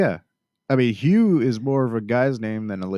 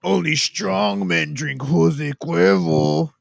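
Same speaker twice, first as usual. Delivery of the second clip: slow, higher-pitched macho boast, put on; mock strong man; 5 semitones higher, slower than usual. Drinker teasing a friend about tequila, mock macho. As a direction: drag it out like a hammy tough-guy slogan.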